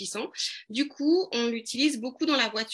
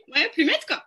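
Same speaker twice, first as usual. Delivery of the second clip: en riant; 6 semitones higher, 7 dB louder, faster than usual. Real, not acted. Rit après l'accent raté.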